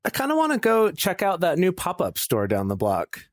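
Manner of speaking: high-pitched, hopeful suggestion, falling toward the end; upbeat invitation. Acted. Jace invites partner out to a new store, upbeat. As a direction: float a keen invitation in a high voice.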